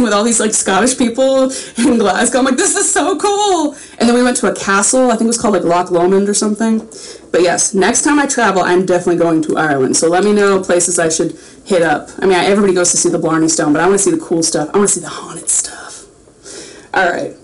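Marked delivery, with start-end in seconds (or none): 2.6-3.8 high-pitched mock squeal of delight; retold excitement. Retelling her thrill at dancing. 14.7-16.1 soft, spooky whisper; hushed, mock-spooky aside; playful whisper. Confides a spooky wish.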